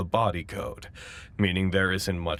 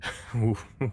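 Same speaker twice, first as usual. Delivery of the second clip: awkward laugh; 3 semitones higher than usual. Real, not acted.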